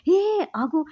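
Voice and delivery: mock-alarmed interruption in narration; urgent halt call. A narrator's reading. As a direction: cut in to halt someone, pitch jumping up. Narrator voices alarmed order to stop.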